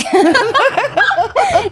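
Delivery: high, loud burst of laughter; real laugh. Real, not acted. Storyteller laughing at her own bad accent, real laugh. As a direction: laugh hard at your own attempt. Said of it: "It's not the best".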